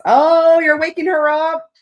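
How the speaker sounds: high, loud delighted gasp at the cat; excited cooing. Real, not acted. Streamer delighted as Angel Eyes wakes the cat, excited high coo. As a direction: squeal as the cat gets woken.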